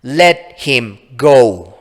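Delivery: loud, drawn-out slow yell. Acted. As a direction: stretch each word as a command. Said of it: "She yelled".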